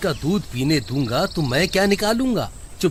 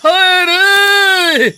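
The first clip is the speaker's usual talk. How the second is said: pained shout; 14 semitones higher, 7 dB louder, much slower than usual. Acted. Thrown by buffalo's horn.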